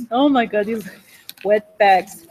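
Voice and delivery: ordinary talk, nothing marked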